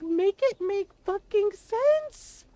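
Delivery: high, slow, loud, drawn out in disbelief; exasperated outburst. Real, not acted. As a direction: spell it out to the sky, fed up.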